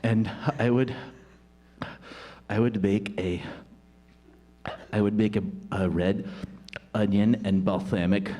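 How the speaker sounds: low, halting, earnest wooing; bashful suitor searching for words; awkward lovestruck student. Acted. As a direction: offer each dish shyly, with long pauses.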